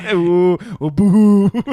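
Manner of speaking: high, loud, hammed-up boo hoo; mock boo hoo. Friend mocking self-pity with a fake boo hoo. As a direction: fake cry it.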